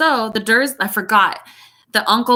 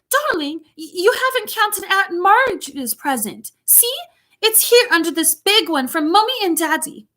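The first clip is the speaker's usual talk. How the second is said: high-pitched British mum, coaxing; fussy high British mother voice; doting mother; 8 semitones higher than usual. A narrator's reading. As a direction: placate a spoiled child in a high voice. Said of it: "I'm doing a British accent".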